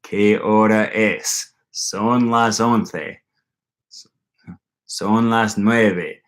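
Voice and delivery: slow, drawn-out mock drill Spanish; mock beginner Spanish. Sitting through a beginner Spanish class, slow mock drill. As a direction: spell out each word like a bored student repeating the drill. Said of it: "sitting there going".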